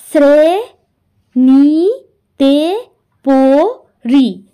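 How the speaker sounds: শ্রেণীতে পড়ি stretched very slowly; syllable by syllable. Shown as a demonstration. Teacher counting syllables aloud, slow. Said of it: "তাহলে কি আমি".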